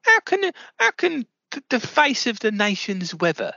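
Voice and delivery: high, squeaking outrage; shocked that a forecaster could be called terrible; aghast. Acted. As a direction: sputter up high in disbelief. Interviewer baffled by insult.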